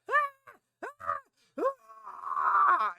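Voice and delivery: loud, very high screams; mock screaming. Player retelling screams while walking into the truck, high loud screaming. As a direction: re-enact terrified shrieking at full pitch. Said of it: "I'm screaming".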